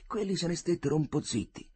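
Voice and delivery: ordinary delivery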